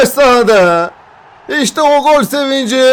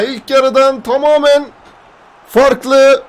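ordinary talk, nothing marked

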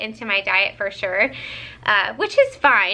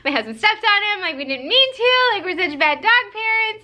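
high, sheepish retold admission; a light, embarrassed high whine; mock guilty confession; 9 semitones higher than usual. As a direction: confess like a mortified dog parent, pitched up. Retelling confession to vet.

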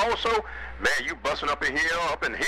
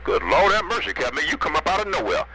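ordinary talk, nothing marked

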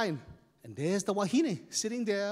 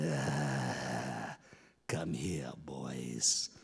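menacing whisper; 6 dB quieter, much slower than usual. Acted.

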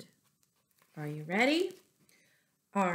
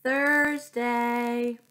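cheery greeting; 6 semitones higher, 6 dB louder, much slower than usual. Real, not acted. Greets children at story time.

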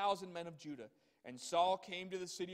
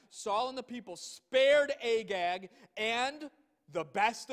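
each item slowed and punched louder; emphatic listing; 4 semitones higher, 6 dB louder, slower than usual. Shown as a demonstration. Preacher hammering the list to the congregation, emphatic. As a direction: lean hard on every spared thing. Preacher stressing what was spared.

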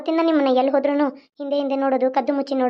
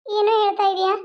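high put-on girl's voice, fretting; a frightened village belle complaining about the loafer; coy heroine voice raised high; 7 semitones higher than usual. Heroine alarmed for her parents.

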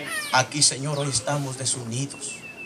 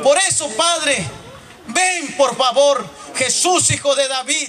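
high, loud pleading cry to God; impassioned shouted prayer; 12 semitones higher, 5 dB louder than usual. Real, not acted. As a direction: pray at full force, begging for your sons. Prayer at full force.

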